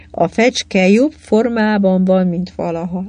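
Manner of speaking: plain reading voice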